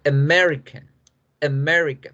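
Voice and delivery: "American, America" with weak uh for each A; English schwa pronunciation. Shown as a demonstration. Teacher models the dictionary word for students, English pronunciation. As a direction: punch MER in "American, America", reduce the A's. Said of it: "no se pronuncian A".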